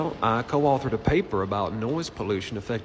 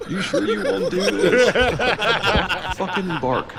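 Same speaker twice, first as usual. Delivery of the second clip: cracking up mid-line, loud and high; barely getting the words out through laughter; laughing; 9 semitones higher, 8 dB louder than usual. Acted. Improv scene, laughing while acting.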